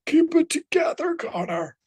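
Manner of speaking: squeaky high mock self-scolding; steady yourself with a nervous pinched voice; self pep talk. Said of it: "I was like".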